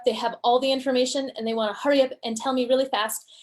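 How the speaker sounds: racing through it fast, no pauses; hurried student cramming information; rushed speech. Shown as a demonstration. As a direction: cram every detail in at top speed. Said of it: "talking like this".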